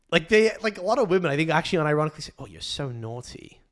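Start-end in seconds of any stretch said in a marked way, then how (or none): 2.4-3.6 slow, soft British mock come-on. Host imitating British women's dirty talk, mock British accent. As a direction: imitate a naughty British woman, softly and slowly. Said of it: "Why do the Brits talk like school children?".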